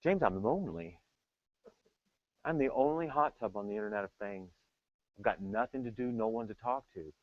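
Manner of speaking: a touch of self-pity; lightly voiced sad hot tub; lonely hot tub. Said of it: "told me".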